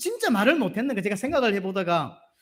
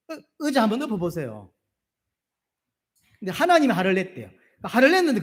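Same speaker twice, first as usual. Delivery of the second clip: ordinary delivery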